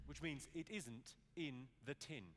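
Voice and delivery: ordinary talk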